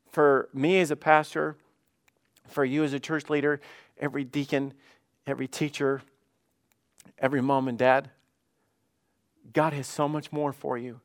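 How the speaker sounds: normal talking voice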